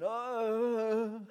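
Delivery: high, loud imitated moan; drawn-out mock groan of suffering. Addict in bed answers the offer of prayer, mock groan. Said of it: "I could hear them groaning".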